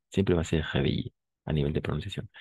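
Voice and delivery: ordinary talk, nothing marked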